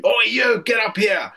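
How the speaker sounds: high mock shout of an order; angry corporal. Corporal summoning recruit who gave him the finger, mock shout. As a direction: imitate a corporal yelling someone over, without full volume. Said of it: "middle finger up at the corporal".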